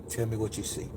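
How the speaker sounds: very soft, low prompt; gently coax, imitating a nice woman; soothing voice. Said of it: "hearing that soothing voice".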